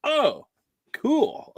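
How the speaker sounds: squeaky high "Oh, cool"; high mock surprise. Vendor reacts to Igor, squeaky fake surprise. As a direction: jump way up in pitch, faking delight. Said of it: "I was like".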